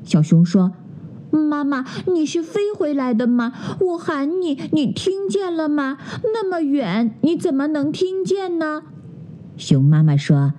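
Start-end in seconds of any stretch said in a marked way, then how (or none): 1.3-8.8 higher, softer cub voice full of questions; a wide-eyed cub asking mum; curious cub questions mum; curious little cub. Acted.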